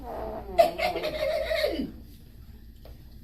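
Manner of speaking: high airy trickster laugh; sly high laugh. Acted. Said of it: "Anansi laughed".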